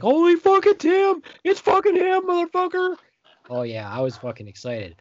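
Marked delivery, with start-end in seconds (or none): 0.0-3.0 much higher, louder, slowed fan yell; ecstatic scream. Retold or imitated. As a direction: shriek with pure fan joy. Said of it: "I was like".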